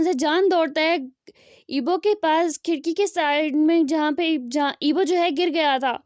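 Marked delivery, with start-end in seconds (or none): none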